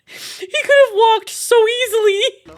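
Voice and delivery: very high, drawn-out squeal of amusement; laughing disbelief. Real, not acted. Gamer mocking a helicopter ride next door, laughing in disbelief. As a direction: squeal it slowly, cracking up.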